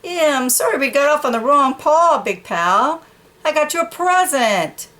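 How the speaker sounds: high, bright apology; cheery dog. Acted. Dog making up with the horse, high and cheery. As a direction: make up with a gift.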